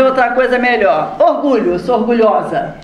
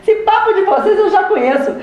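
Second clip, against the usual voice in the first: high, laughing mockery of the spirit; mocking spirit; 6 semitones higher than usual. Spirit at the séance mocking spiritists, high and laughing. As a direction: scoff at the spiritists through a laugh. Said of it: "Ele disse".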